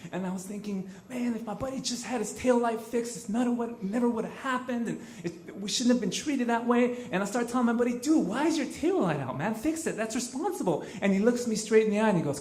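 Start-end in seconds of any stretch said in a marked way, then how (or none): 1.1-7.1 mildly indignant, pitched up throughout; retold anger. Thinking after the police take off, mildly angry. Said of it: "I remember being so angry". 8.0-10.8 higher-pitched, exasperated telling-off of a friend; retold scolding. Telling his buddy off over the taillight, exasperated.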